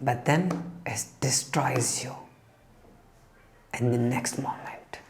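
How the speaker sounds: ominous whisper. Real, not acted. Speaker warns listeners about bullshit's cost, whispered. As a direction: drop to a hushed whisper. Dramatic moral of the story.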